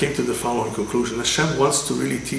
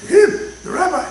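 much higher, louder astonished exclamation; shocked reveal; 7 dB louder than usual. Acted. Crowd revealing the rabbi, high and astonished. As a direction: gasp it out in disbelief.

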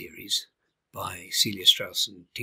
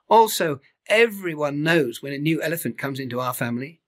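a touch higher friendly calf; lightly voiced Tabu; 4 semitones higher, 5 dB louder than usual. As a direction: bigger calf welcoming him.

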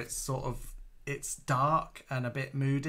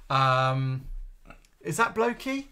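ordinary talk

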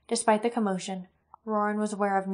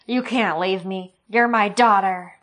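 slow, loud, pleading cry; desperate plea; 8 dB louder, slower than usual. Acted. Sloane begging his daughter not to leave him, slow and loud. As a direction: beg her not to go.

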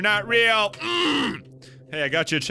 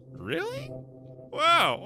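much higher, squealed "Really?"; shrieked disbelief; much slower than usual. Real, not acted. Streamer reacting to a silly line, shrieking in disbelief. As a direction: squeal it in mock amazement.

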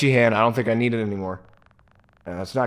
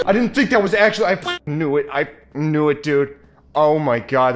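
shocked; 5 semitones higher, 6 dB louder than usual. Real, not acted. Reacts to jumpscare.

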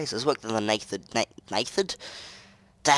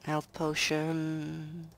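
drawn-out mock; 4 semitones higher, 10 dB quieter, much slower than usual. Reading item name.

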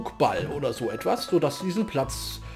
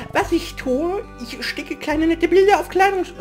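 put-on high girlish voice; 9 semitones higher than usual. Second reading of same NPC line.

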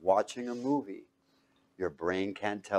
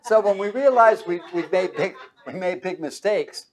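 laughing through the words; 9 semitones higher, 6 dB louder than usual. Real, not acted. Preacher to congregation, laughing as he speaks. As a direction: chuckle while admitting mistakes.

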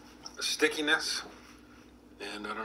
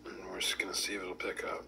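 whispering; 6 semitones lower, 9 dB quieter than usual. Real, not acted. Test in progress.

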